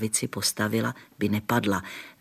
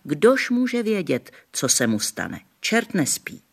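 higher, slow warning granny; wary grandmother; 7 semitones higher, slower than usual. A narrator's reading. Grandmother telling the gamekeeper the devil never sleeps, wary. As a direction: warn like a superstitious granny. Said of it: "říkala".

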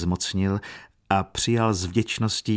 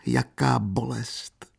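slow, higher acted sob; sobbed lament; 4 semitones higher, slower than usual. A narrator's reading. Katerina alone weeping convulsively, slow sobbing lament. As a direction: draw the words out in pain, as if sobbing. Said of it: "štkala".

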